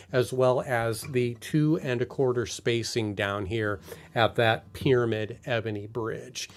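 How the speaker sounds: ordinary talk, nothing marked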